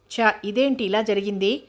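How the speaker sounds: usual speaking voice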